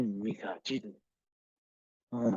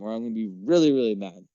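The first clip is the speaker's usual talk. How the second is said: ordinary talk, nothing marked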